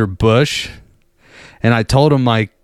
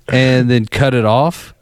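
ordinary talk

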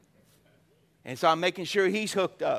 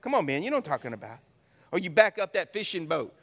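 normal talking voice

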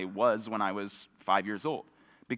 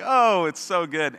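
higher and louder, slow, chuckling like a proud dad; dad delighted by his joke; 8 semitones higher, 8 dB louder, slower than usual. Retold or imitated. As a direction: imitate the dad who laughs at his own joke. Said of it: "he'd, like, slap his knee and be like".

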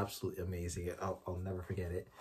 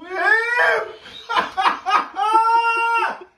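high laugh breaking into tears; joyful crying laugh; 17 dB louder than usual. Real, not acted. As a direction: laugh and cry with joy at once. Recorded joyful reaction.

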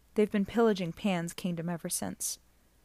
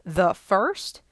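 surprised; 7 dB louder than usual. Acted. Percy shocked at the news.

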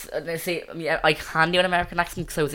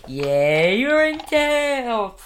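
loud, high, drawn-out American cheer; whooping cheer in an American accent; 9 semitones higher, 8 dB louder, much slower than usual. Shown as a demonstration. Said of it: "That was American".

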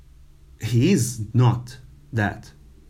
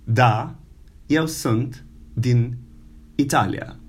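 slow model sentence. Shown as a demonstration. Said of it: "So try to use it".

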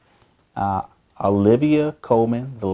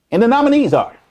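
announcer voice; 10 semitones higher, 5 dB louder, faster than usual. Pretend. Awards host announcement.

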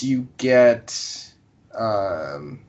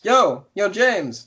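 lightly voiced villager, pitched higher; higher, friendly game-character call; 8 semitones higher, much slower than usual. Villager calls out to the player.

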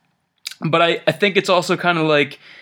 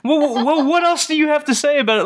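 mock interrogator; 10 semitones higher, much faster than usual. Teasing a friend who interrupts.

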